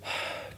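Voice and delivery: heavy sigh. Real, not acted. After hearing he has aids.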